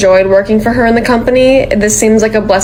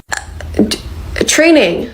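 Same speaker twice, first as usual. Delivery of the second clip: normal talking voice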